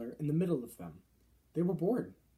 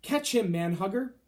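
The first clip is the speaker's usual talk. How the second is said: loud, higher game call; shouted; 5 semitones higher, 6 dB louder than usual. A narrator's reading. Fleshlumpeater tossing the BFG to Manhugger, shouting. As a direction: fling the words after the thrown giant.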